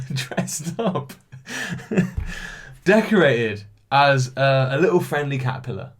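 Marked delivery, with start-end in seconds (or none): 1.3-2.5 laugh. Real, not acted. Laughing at own quiz answer.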